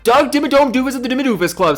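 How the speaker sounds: excited. Real, not acted. Rereading a fan's joke name, he hypes it up. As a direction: rattle it off with glee.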